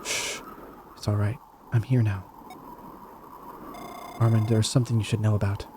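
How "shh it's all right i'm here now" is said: soft comforting hush. Acted. Comforting a frightened woman.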